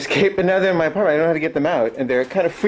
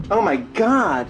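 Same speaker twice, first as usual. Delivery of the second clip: disgusted exclamation; 7 semitones higher, much slower than usual. Real, not acted. Finding bird droppings.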